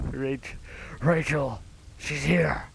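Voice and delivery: a hint of Batman shouting for Rachel; Batman impression. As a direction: ham up a rough Batman voice calling out. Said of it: "That's my best Batman impression".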